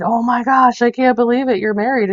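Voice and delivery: mock disbelief, voice lifted high; mind blown. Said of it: "was completely mind blown".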